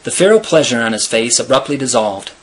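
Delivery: ordinary delivery, nothing marked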